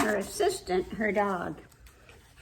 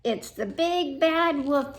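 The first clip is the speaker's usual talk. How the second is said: a touch of alarm, mildly raised; cried out; 6 semitones higher, 3 dB louder than usual. A narrator's reading. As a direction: call it out, lightly alarmed. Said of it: "he cried".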